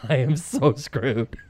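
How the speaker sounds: slow put-on cartoon groan of defeat; mock despair. Host retells Garrison's defeat line, slow mock despair. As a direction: drag it out like a doomed cartoon candidate. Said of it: "And he goes".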